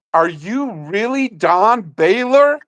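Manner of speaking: put-on high, slow awestruck kid voice; a starstruck boy asking, high and slow; awed kid. Imitating the autograph kid, high and slow in awe.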